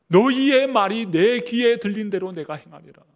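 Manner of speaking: loud, solemn divine pronouncement; booming voice of God. Acted. Preacher voicing God to grumbling Israel, loud and solemn. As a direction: proclaim it as God's judgment. God's words quoted in sermon.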